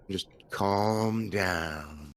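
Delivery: put-on "Just calm down" drawn out very slowly in an Australian accent. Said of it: "in an Australian voice".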